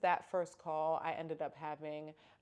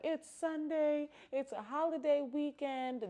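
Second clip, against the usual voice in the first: whiny self; 10 semitones higher, 3 dB louder than usual. Retold or imitated. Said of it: "And I was like".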